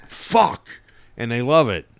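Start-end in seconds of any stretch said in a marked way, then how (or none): 0.1-0.7 much higher New York curse; showing off a New York vowel; exaggerated New York accent. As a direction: let the curse burst out in broad New York. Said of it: "'cause it would come".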